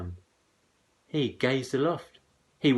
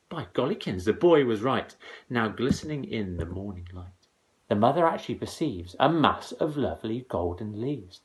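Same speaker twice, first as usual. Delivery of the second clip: usual speaking voice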